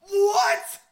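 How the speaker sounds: very high, loud, rising what; a sudden squeak of disbelief; startled what. Real, not acted. Host reacts in shock to the coaches' need, high loud disbelieving what.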